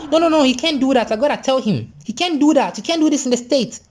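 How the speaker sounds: put-on high-pitched, outraged rich-kid complaint; mimicking an entitled friend's outrage; indignant protest. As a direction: object in a high, scandalized voice. Outraged friend refuses to hush.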